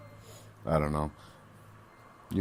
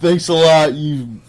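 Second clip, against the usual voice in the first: louder, higher mock thanks; sarcastic thanks; 7 semitones higher, 9 dB louder than usual. Friend sarcastically thanking the judge, loud and higher. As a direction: thank them, dripping sarcasm.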